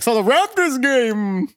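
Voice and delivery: high, drawn-out mock excitement; imitated boss. Boss bringing up the Raptors game, hammed-up high voice. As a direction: stretch it out high like an eager boss. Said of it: "then my boss came".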